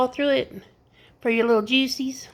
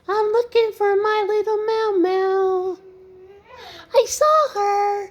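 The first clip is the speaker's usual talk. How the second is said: put-on high sing-song baby talk; 9 semitones higher, slower than usual. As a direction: coo to the toddler in a high playful voice. Grandma baby-talk to toddler.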